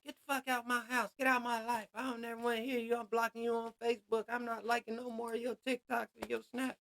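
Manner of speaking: high, heated kicking-out yell; mock angry yell. Telling a snake to get out, high mock-furious yell.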